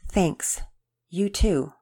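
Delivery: ordinary delivery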